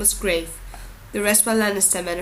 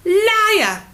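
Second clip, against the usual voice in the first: much higher, louder yell; a girl yelling at the prince; 12 semitones higher, 8 dB louder than usual. A narrator's reading. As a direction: scream the accusation. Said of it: "Ira yelled".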